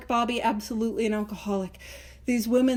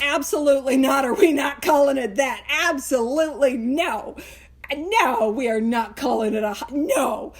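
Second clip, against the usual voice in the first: firm, indignant veto with punched words; flatly refuse, leaning hard on each no; emphatic refusal; 4 dB louder than usual. Acted. Florence vetoing the book title, emphatic refusal. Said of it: "'Cause she was like".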